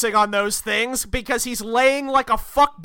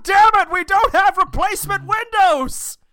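loud, high-pitched angry outburst; exasperated Doom; 8 semitones higher, 4 dB louder than usual. Acted. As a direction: shout it like a furious, fed-up supervillain. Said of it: "To which Doom responds".

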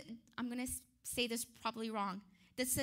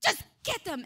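barked order, higher and much louder; angry shouted order; 8 semitones higher, 10 dB louder, faster than usual. Acted. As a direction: yell at the guards to throw them in. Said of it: "he was just so angry".

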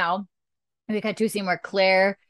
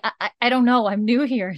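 flustered nurse; 5 semitones higher, 4 dB louder than usual. Retold or imitated. Flustered nurse answers angry doctor.

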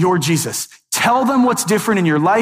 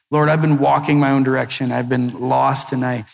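low, softened prayer voice; led prayer; 6 semitones lower than usual. Acted. Models a prayer for listeners.

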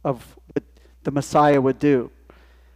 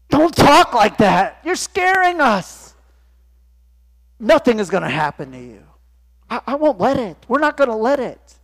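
pleading Peter; 7 semitones higher, 4 dB louder than usual. Acted. Peter pleading with Jesus, higher and anxious. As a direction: beg him not to talk like that.

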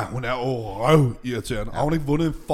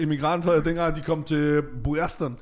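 usual speaking voice

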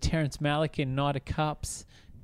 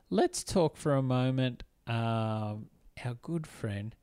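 ordinary delivery

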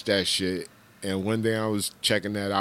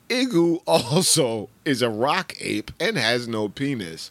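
usual speaking voice

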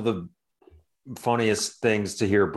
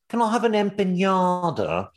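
high British customer mangling Spanish; British accent, "empanada" said with a ny for the n; mock British accent; 8 semitones higher than usual. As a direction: imitate a Brit's over-Spanished order. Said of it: "every N is an Enye".

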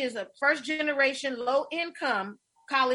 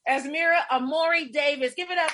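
loud, raised, ringing introduction; announcer introduction; 4 semitones higher, 5 dB louder than usual. Real, not acted. Host introducing former student to audience, proud loud announcement. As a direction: present the guest and rally the applause. Introducing guest, calling for applause.

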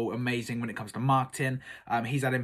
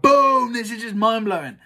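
higher and louder amazed outburst; excited boom; 8 semitones higher, 8 dB louder than usual. Pretend. Viewers reacting to the interview, loud amazed outburst. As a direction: blurt it out, blown away.

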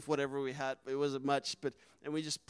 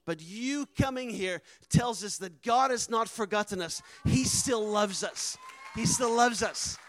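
loud, raised retold declaration of gratitude; impassioned testimony; 3 semitones higher, 7 dB louder than usual. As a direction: build to a fervent, drawn-out cry. Retold heartfelt thanks, building to climax.

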